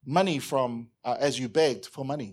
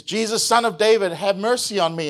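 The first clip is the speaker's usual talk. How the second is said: raised voice crying out for mercy; calling out; 4 semitones higher, 6 dB louder than usual. Acted. Blind beggar calls out to passing Jesus, raised plea. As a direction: beggar calling loudly from the roadside.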